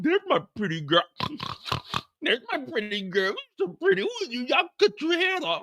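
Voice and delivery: put-on high cooing baby talk to a pet; doting pig owner. Mocking Miguel cooing to his pet pig. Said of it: "acting like he is six years old".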